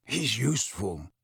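soft, low-key mutter. A narrator's reading. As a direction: mutter it softly under your breath. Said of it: "Sirius muttered".

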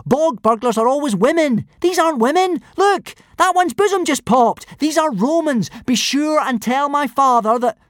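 put-on high, loud urgent boy; urgent Hiccup. A narrator's reading. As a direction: blurt it out urgently.

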